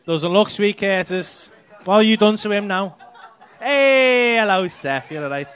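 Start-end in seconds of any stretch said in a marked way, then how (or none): none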